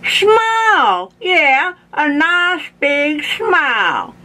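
put-on high, drawn-out old man voice; insistent toothless Grandpa. A narrator's reading. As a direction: coax slowly in a high slurred wail. Grandpa coaxes officer to smile.

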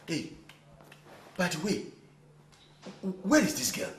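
normal talking voice

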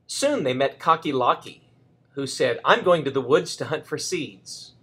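gentle, lively storybook reading; reading to a child. A narrator's reading. Grandparent reading Chicken Little to Reese, lively storybook voice. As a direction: read it aloud for a little grandchild.

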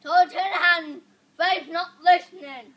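loud yell at the camera; shouting. Real, not acted. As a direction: yell it out, full volume.

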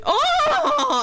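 a high, drawn-out laughing oh; laughing gasp. Real, not acted. Streamer sees her Sim's backside, gasping with laughter.

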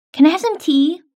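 normal talking voice

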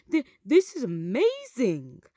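mildly thrilled discovery; excited cry. A narrator's reading. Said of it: "Milo cried".

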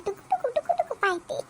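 put-on baby talk. Grandma describing baby's gaze.

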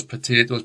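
"potatoes" with an AY, puh-TAY-toes; American pronunciation. Shown as a demonstration. Teacher showing the American way, "potatoes" as puh-TAY-toes. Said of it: "They say potatoes".

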